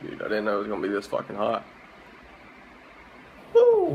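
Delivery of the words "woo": sudden high yelp from the burn; loud whoop. Real, not acted. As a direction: yelp it out, mouth on fire. Burning mouth from ghost pepper.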